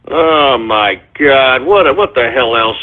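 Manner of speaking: low, slow, drawn-out exasperation; weary dismay. Real, not acted. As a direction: sink into low-pitched disbelief. Host dismayed by the calls.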